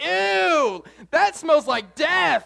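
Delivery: hammed-up gross-out yell; disgusted shout. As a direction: recoil in mock disgust. Bystander disgusted by sneeze.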